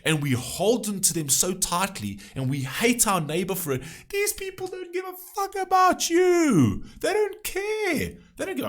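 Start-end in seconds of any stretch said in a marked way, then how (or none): 4.1-8.7 real high-pitched, drawn-out shout; impassioned angry shout. Real, not acted. Telling viewers politicians don't care about them, shouting angrily. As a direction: cry it out high and slow, outraged.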